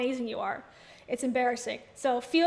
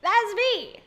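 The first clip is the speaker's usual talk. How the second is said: higher mocking That's me with falling end; weird voice imitation; 7 semitones higher, 4 dB louder than usual. Teasing cohost by copying her voice, higher. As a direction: mimic cohost's odd voice, pitched up. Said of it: "She has that weird voice sometimes".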